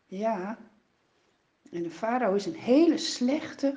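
ordinary delivery

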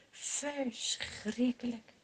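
slow, soft Verschrikkelijk drawn out in grief; sorrowful. A narrator's reading. Narrator to children about David's grief, slow and soft. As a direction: breathe Verschrikkelijk out slowly and quietly.

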